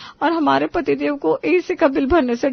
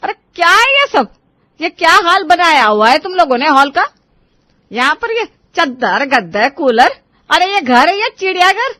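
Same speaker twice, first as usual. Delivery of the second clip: scolding; 3 semitones higher, 4 dB louder than usual. Acted. Mother-in-law scolds messy daughters-in-law.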